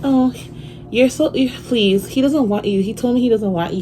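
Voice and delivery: high, loud mock voice of the other woman; mimicked rival's taunt.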